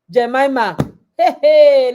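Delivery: excited exclaim. Acted. Exclaiming about Jemima.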